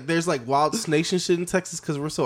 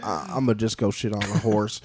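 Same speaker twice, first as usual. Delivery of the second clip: put-on lower, laid-back Texas accent; Texas pigeon; 5 semitones lower than usual. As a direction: put on a low Southern voice. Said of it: "And the Texas pigeon's like".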